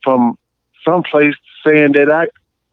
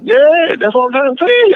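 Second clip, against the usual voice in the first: higher, eager "yeah"; excited; 10 semitones higher than usual. Real, not acted. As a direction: jumps in with eager agreement. Excited agreement after laugh.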